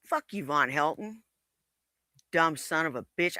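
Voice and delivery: muttering. Real, not acted. Muttered insult at the other.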